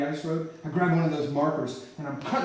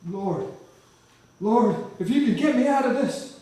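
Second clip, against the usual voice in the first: desperate prayer; 8 semitones higher, 5 dB louder than usual. Retold or imitated. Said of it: "I gotta pray".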